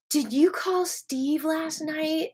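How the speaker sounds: high, slow mock classmate voice; taunting classmate. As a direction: draw it out slowly, high-pitched, as an accusation. Classmate confronting her in a retold story.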